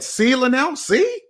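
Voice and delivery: high sing-song "see?"; playful high-pitched taunt; mock teasing. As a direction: tease like a knowing mentor. Mock scolding imagined mentor.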